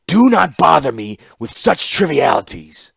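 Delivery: a ruler dismissing a subordinate, loud and curt; dismissive. Acted. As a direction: wave her away with disdain. Mishra dismisses Ashnod.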